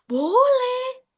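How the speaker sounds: high, firm yes; emphatic yes. Real, not acted. Answering her own question for listeners, emphatic yes. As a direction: settle the question brightly. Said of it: "boleh nggak kita merekomendasikan".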